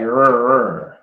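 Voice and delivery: mock R growl; growled R sounds. Shown as a demonstration. Teacher mocking her own heavy American R. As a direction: exaggerate the American R. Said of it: "mine was just like".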